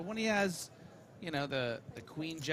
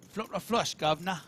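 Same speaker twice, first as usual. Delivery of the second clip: loud, higher Cockney delivery; Cockney line fed by a co-caster; Cockney accent; 4 semitones higher, 8 dB louder than usual. Shown as a demonstration. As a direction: give it a brash Cockney swagger. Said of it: "You do a Cockney accent".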